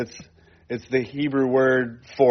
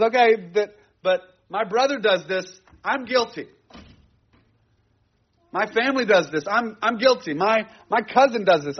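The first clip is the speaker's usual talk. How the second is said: higher, pleading confession voice; Ezra confessing; 7 semitones higher than usual. Retold or imitated. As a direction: voice Ezra owning the people's guilt. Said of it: "And he goes".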